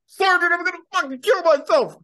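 high, loud whining recruit; whiny recruit voice. Acted. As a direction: wail it like a panicking airman. Recruit voice again.

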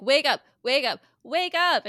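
yelling wake up. Retold or imitated. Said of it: "he, like, yells at Penny".

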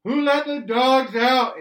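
loud, slow, drawn-out yell, high; a parent yelling the question up at the kids, slow and loud; screaming at the kids. Retold or imitated. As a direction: bellow it slowly across the house at the kids. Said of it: "I'm just screaming at the kids".

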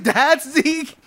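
very high astonished echo; incredulous question. Retold or imitated. Storyteller repeating his shock that this is Zeke, incredulous.

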